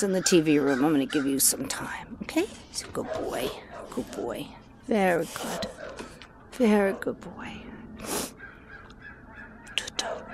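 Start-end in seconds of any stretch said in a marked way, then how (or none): 0.8-5.7 hushed and tender, just for the pet; soft whisper. Real, not acted. Soothing the dog, whispering. 6.5-10.2 slow whispered praise for the dog. Real, not acted.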